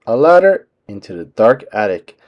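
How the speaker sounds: ordinary delivery, nothing marked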